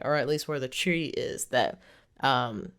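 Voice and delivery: ordinary talk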